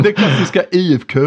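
lightly voiced Skåne accent on "I F K", vowels rounded toward ö; a hint of Kristianstad dialect, said high and amused. Podcast host lightly imitating the Kristianstad dialect, a hint of Skåne accent. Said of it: "där har vi precis det ljudet".

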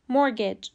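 مورگیج said MOR-gij, with no T; correct pronunciation. Shown as a demonstration. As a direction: silent T in مورگیج, short second vowel, falling. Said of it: "تلفظ درست".

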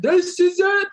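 high hammed-up karaoke belt; impression of men at karaoke. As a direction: sing it high like the boys. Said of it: "That's my impression of men".

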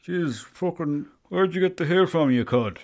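high, loud mocking jeer; mocking quote. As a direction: jeer like a slagging mate. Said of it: "People were saying to me".

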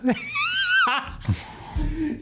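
sudden high shrieking laugh. Real, not acted. Laughing at his own threat, high squeal.

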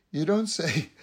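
ordinary talk, nothing marked

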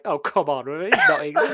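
mock your dad's incredulous correction; dad's scoffing disbelief. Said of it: "my dad was like".

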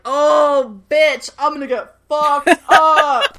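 loud, high, drawn-out crowing; gleeful mock threat. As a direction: crow it slowly, breaking into a laugh. Acting Matt's triumphant reply to Siler.

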